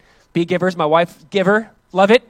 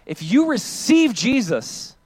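ordinary talk

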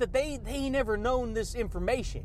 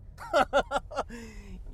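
quick, rippling run of ha-has; chuckling laugh. Real, not acted. Podcaster amused by own ego-and-tacos joke, chuckling laugh.

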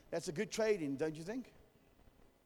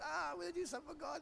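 high, fast, eager mimicry; mock-excited young guys; 9 semitones higher, 6 dB quieter, faster than usual. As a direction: gush like a naive recruit, pitched high and quick. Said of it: "They're, like, so excited".

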